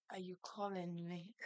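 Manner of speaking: mock-dazed reply from a hungry daze; dazed mumble. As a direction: mumble it like a limp vegetable. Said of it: "I be like vegetable".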